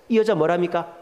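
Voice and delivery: ordinary delivery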